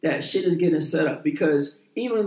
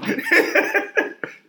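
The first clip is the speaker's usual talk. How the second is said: laughing at being teased; high burst of laughter. Real, not acted. Teased for getting emotional, a burst of high laughter.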